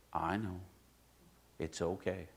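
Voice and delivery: soft, low, comforting reply; gentle reassurance. Retold or imitated. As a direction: reassure tenderly, like a father calming his child. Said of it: "And he goes".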